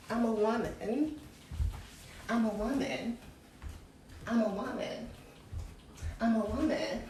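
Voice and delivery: hushed affirmation chant; soft repeated mantra. Acted. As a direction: murmur it again and again like a chant. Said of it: "repeated soft chant of identity".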